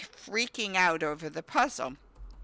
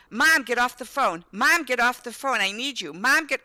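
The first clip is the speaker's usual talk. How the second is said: put-on higher, louder nagging kid; insistent child; 4 semitones higher, 4 dB louder than usual. Son pestering mom on the phone, louder and higher. Son demands she hang up.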